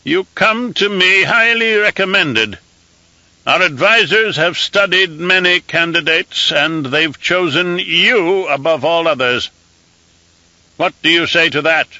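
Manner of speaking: high, thin voice of an aged emperor; an old ruler used to giving firm commands; reedy scratchy old voice. Acted. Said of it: "in a reedy, scratchy voice".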